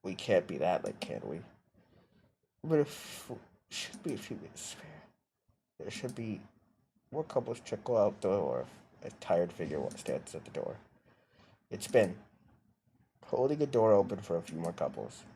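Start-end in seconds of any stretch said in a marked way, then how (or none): none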